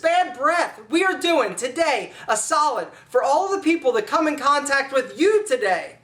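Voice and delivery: ordinary talk, nothing marked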